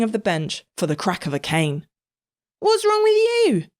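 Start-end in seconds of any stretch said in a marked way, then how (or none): 2.6-3.7 put-on boy voice. A narrator's reading. Patrick asks.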